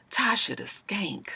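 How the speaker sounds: soft whispered "Tasha the Skank" repeated; menacing whisper. Retold or imitated. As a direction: whisper "Tasha the Skank" softly, a little slow.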